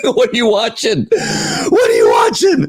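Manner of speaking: high-pitched, laughing disbelief; cracking up in disbelief; incredulous laughing. Real, not acted. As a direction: ask it through helpless laughter, pitch leaping up. Incredulous laughing at Biden's supporters.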